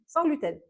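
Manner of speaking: "sans gluten" repeated with a firm falling close; stressed repeat. Shown as a demonstration. Nutritionist clarifying for viewers, firm stress on "sans gluten". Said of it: "je précise".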